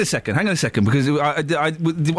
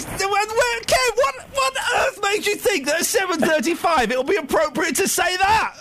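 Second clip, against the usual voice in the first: outraged exclamation; 10 semitones higher than usual. Real, not acted. Host scolding caller on air.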